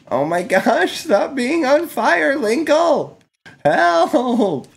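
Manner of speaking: high frantic pleading; mock panic. Real, not acted. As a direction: shriek at the burning character to stop. Panic as character burns.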